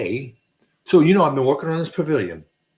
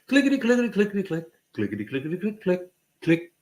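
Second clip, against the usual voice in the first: clicking noises; 3 semitones higher, faster than usual. Retold or imitated. Clicking caulking gun sound effect.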